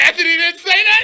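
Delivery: loud, high incredulous question; shocked disbelief. Real, not acted. Co-host hears the husband overheard it all, shouted disbelief. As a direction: blurt it out in astonishment.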